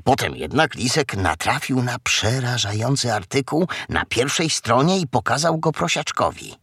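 ordinary delivery

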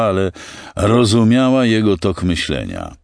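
ordinary delivery

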